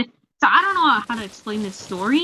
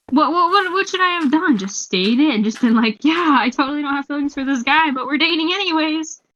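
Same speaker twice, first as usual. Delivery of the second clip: high-pitched mock exasperation; exasperated self-defence; 6 semitones higher than usual. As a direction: protest in a high, hammed-up voice. Acts out exasperated self-defence after guilt.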